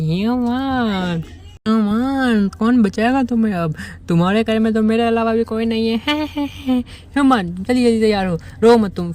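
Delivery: hint of a sinister creature toying with prey; creepy teasing creature. Ken teasing the scared human that nobody will save him, lightly menacing.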